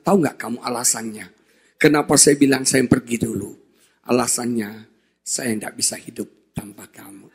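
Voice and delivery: soft and low, the voice breaking into tears at the end; tearful confession. Retold or imitated. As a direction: confess it quietly, near crying. Confessing love to his wife. (pengakuan lirih hampir menangis)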